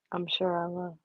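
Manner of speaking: normal talking voice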